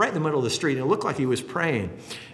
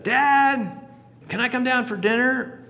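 put-on higher, louder boy calling "Dad"; pleading boy; 11 semitones higher, 3 dB louder than usual. Son upstairs asking his father about dinner, pleading boy voice. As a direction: call down to father like a young son.

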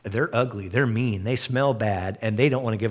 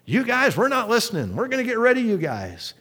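mocking angry crowd; 10 semitones higher than usual. Crowd threatening Caleb and Joshua.

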